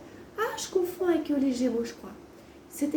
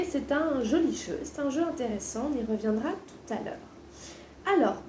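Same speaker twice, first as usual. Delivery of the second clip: normal talking voice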